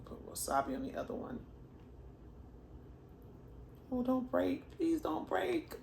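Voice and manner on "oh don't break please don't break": pleading. Real, not acted. Food about to break apart.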